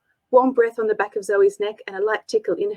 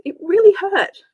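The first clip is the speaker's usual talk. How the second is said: higher, louder indignant complaint; through gritted teeth; 10 semitones higher, 6 dB louder than usual. Acted. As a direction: snap back, stung and cross. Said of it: "Zoe gritted her teeth".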